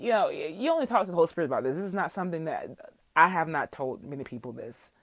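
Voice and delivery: ordinary delivery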